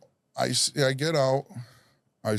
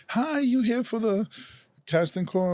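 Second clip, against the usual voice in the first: high, slow, friendly receptionist voice; young intern girl; 11 semitones higher than usual. Retold or imitated. Intern girl greeting the arriving actor, high and bright.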